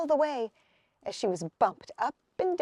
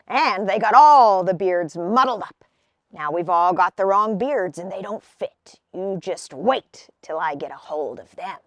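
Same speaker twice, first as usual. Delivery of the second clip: louder, lower troll rant; angry troll; 6 semitones lower, 6 dB louder than usual. A narrator's reading. Troll rants to Hazel about the boys, louder and lower. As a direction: threaten the boys like a cross troll.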